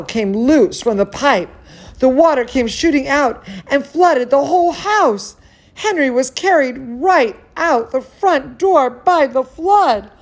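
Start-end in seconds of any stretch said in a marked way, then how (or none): none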